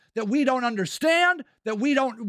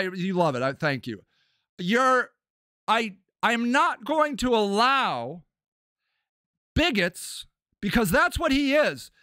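usual speaking voice

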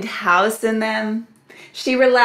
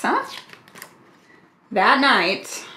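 plain reading voice

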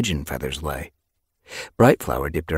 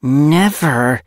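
loud, heated denial, raised pitch; furious; 9 semitones higher, 9 dB louder than usual. A narrator's reading. Apprentice accused of being scared, furious. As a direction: deny it with fierce anger. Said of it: "voice was low and furious".

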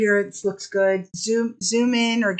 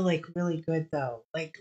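ordinary delivery

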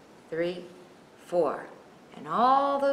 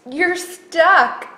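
gloating; 8 semitones higher, 10 dB louder than usual. Acted. Triangle gloats.

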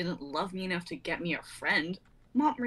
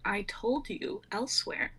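whispered; slower than usual. Acted. Cat's mysterious answer.

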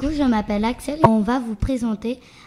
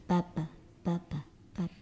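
softer and softer, dying away; fading echo; 3 semitones lower, 10 dB quieter, faster than usual. Acted. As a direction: let each repeat fade towards silence. Fin du poème en écho. (écho qui s'éteint doucement)

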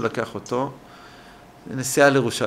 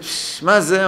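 teacher's mock yell; 6 semitones higher, slower than usual. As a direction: mildly mimic a teacher's startled cry. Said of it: "והמורה צועקת". (חיקוי קריאת מורה בקול גבוה)

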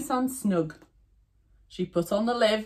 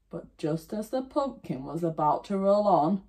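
ordinary delivery

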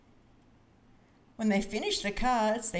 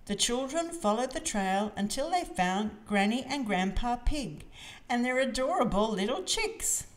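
plain reading voice

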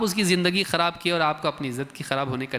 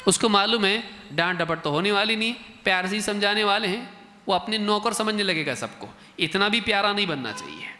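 ordinary talk, nothing marked

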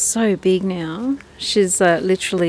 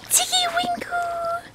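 squeaky high call to the duck. Real, not acted. Owner calling her duckling, very high. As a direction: sing out her name high and drawn out.

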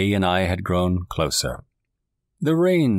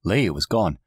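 usual speaking voice

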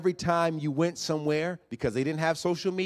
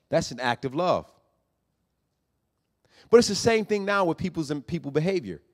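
ordinary talk

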